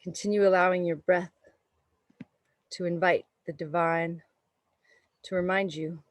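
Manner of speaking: calm, unhurried meditation voice; guided meditation. Acted. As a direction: guide the breath gently. Leads a yoga meditation.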